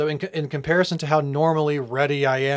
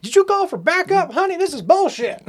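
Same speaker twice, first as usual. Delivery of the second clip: high, loud panicked husband; mock frantic husband; 4 dB louder than usual. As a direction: squeal it like a scared husband. Voicing Fred to wife.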